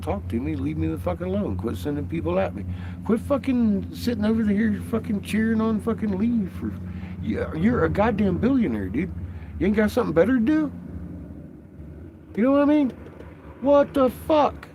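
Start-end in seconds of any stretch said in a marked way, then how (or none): 3.1-14.7 worked-up rant at a billionaire watching the stream; rising rant. Real, not acted. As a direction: vent at him, voice climbing.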